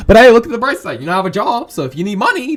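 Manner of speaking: loud, high, cheery turn; upbeat consoling. Acted. Boy cheers up girlfriend about new job, upbeat. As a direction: brighten up to sell the good side. Consoles her with a bright side.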